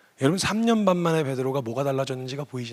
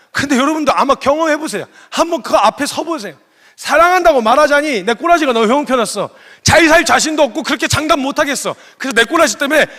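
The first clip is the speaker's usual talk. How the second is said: much higher and louder, pleading preacher; fiery preaching; 10 semitones higher, 10 dB louder than usual. Acted. Preacher urging the congregation to face the question, fiery and loud. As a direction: drive it home to the congregation.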